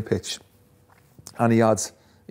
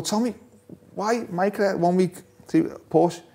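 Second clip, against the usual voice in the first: higher-pitched, lightly acted bafflement; puzzled questioning; 7 semitones higher than usual. Asking Tommy about his two cars, mildly baffled. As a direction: re-enact a bemused question, pitched up.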